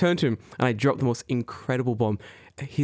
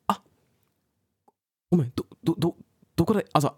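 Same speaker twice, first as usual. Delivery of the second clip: usual speaking voice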